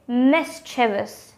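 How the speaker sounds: "mischievous" as MIS-chuh-vus; correct three syllables. Shown as a demonstration. Teacher giving viewers the answer, correct three syllables. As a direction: stress the first syllable of "mischievous". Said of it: "with just three syllable".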